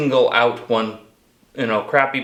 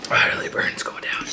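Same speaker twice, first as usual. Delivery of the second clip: whispering; 4 dB quieter than usual. Real, not acted. Whispered commentary aside.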